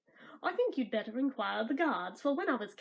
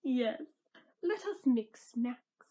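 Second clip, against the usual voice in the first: plain reading voice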